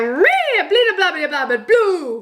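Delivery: high, whiny gibberish of an unwilling Sim; Sim impression. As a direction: grumble in high-pitched Simlish. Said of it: "such a good Sim impression".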